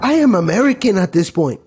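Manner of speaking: put-on Mexican-sounding accent. Botched impression claiming to be American, Mexican-sounding accent. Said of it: "That was more Mexican".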